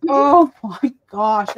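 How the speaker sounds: imitated husband's drawn-out dismay; exasperated husband. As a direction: drag each word out in disbelief. Husband reacting to her crying.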